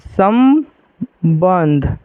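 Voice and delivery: संबंध stretched out very slowly while writing, म sound clear; slow teaching demo. Shown as a demonstration. Teacher writing the word on the board, slow spelled-out demo. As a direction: draw संबंध out syllable by syllable. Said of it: "हम संबंध को ऐसे लिख सकते हैं".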